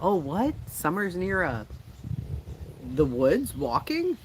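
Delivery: high, put-on questioning voice; mock astonishment. Mocking what spirit box supposedly says.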